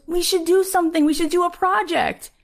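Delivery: high-pitched, eager proposal; enthusiastic. Retold or imitated. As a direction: act out urging a new partner on. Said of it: "Because I have enthusiasm".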